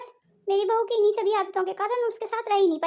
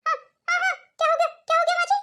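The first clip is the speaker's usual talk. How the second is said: startled awake; 8 semitones higher, faster than usual. Acted. Woken suddenly by mother-in-law.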